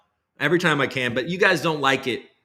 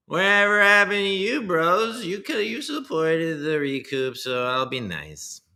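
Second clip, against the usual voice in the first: high put-on coin dev voice; 7 semitones higher, slower than usual. Streamer parodying a crypto dev's new year message, high and slow. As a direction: ham up the goofy developer persona, stretched out. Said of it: "spouting off in that voice".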